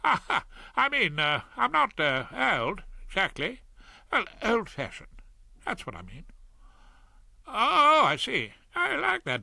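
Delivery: lightly voiced barrister flirting awkwardly; flustered Claude. A narrator's reading.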